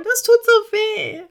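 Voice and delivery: high, slow hurting whimper. Acted. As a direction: whine through the pain. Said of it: "Er wimmerte leise".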